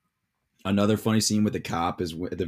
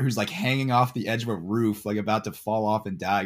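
ordinary talk, nothing marked

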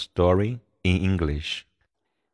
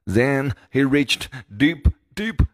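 building suspense; 10 semitones higher than usual. A narrator's reading. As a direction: climb in pitch to the reveal. Building suspense deep inside George.

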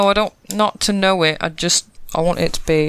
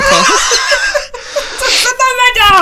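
loud, high burst of laughing; helpless laughter; 6 dB louder than usual. Real, not acted. Player breaking down over the dice result, helpless laughter. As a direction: crack up completely, almost crying. Table cracks up at the roll.

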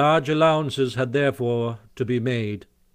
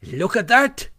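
high, loud show-off exclamation; boastful cry; 10 semitones higher, 5 dB louder than usual. A narrator's reading. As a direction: crow over your prize. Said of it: "he cried, displaying it".

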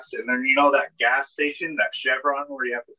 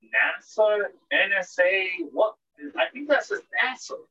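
higher, puzzled spelling out of the letters; puzzled reading; 7 semitones higher than usual. Retold or imitated. Reading the planes' letters, puzzled and higher. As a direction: squint up and sound out nasa, bewildered. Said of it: "I was like".

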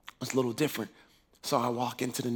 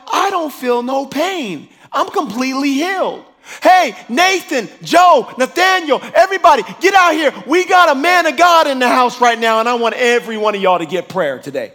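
excited calling out; 10 semitones higher, 14 dB louder than usual. Retold or imitated. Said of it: "And I was like".

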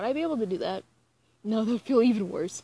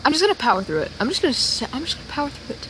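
self pep talk; 5 semitones higher, 9 dB louder, much faster than usual. Real, not acted. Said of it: "You're a tough bitch".

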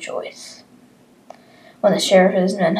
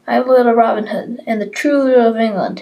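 put-on defiant boy; 5 semitones higher, 7 dB louder, faster than usual. Boy defying the sheriff.

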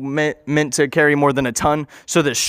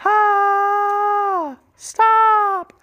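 very high, long mock scream; hammed-up high cry for help. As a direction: imitate a victim's drawn-out shriek. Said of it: "he's screaming for help".

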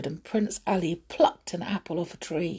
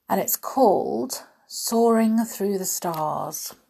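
ordinary delivery

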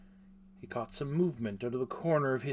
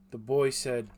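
ordinary delivery, nothing marked

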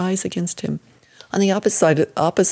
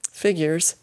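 ordinary delivery, nothing marked

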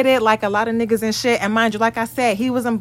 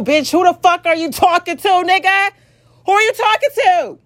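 rising higher, indignant and louder; who do you think you're talking to; indignant outrage; 8 semitones higher, 3 dB louder than usual. Real, not acted. Ranting to viewers about her ex, indignant outrage. Furious at ex's demand.

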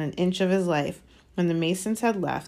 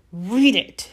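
evil hiss; 3 semitones higher, 5 dB louder than usual. Acted. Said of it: "he hissed evilly".

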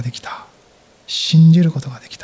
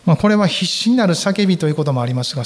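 normal talking voice

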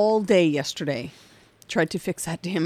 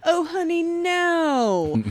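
high, louder sweet scolding; condescending; 11 semitones higher, 5 dB louder, much slower than usual. Acted. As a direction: talk down like to a child. Condescending banter.

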